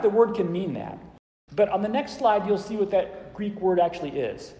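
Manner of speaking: ordinary talk, nothing marked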